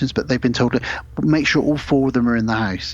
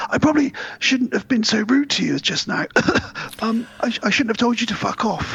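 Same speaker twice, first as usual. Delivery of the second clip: high, prim, over-apologetic English voice; overly polite Brit; 12 semitones higher than usual. Retold or imitated. Choked husband apologizes to the alien woman, high and prim. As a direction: grovel politely while being choked. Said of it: "It's very polite and British".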